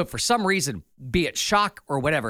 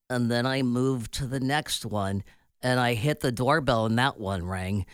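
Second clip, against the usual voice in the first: slug voice; 5 semitones lower than usual. Pretend. Acting out the slug's voice.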